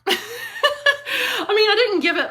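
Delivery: high laugh through words. Real, not acted. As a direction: giggle it out. Laughing after dog poop verdict.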